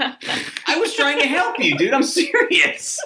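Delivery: laughing. Real, not acted. Laughing through his defense.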